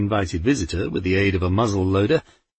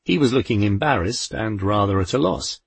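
usual speaking voice

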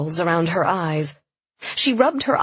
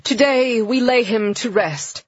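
slow, raised funeral address; solemn proclamation; 4 semitones higher, slower than usual. A narrator's reading. Sienna addressing mourners at her son's funeral, solemnly proclaiming. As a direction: proclaim it slowly, pitched up to carry. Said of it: "Her voice echoed on the air".

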